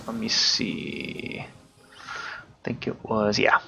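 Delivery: very soft, slow murmur to self; thinking aloud. Real, not acted. Player checking a detail to himself, murmured slowly. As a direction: trail into a slow, barely audible mumble.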